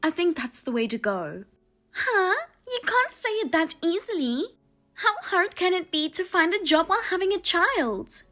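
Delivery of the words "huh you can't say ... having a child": higher-pitched, huffy sister-in-law voice; indignant sister-in-law. Acted. Sister-in-law scolding her brother's wife, indignant and higher. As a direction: play the offended sister-in-law taking offence.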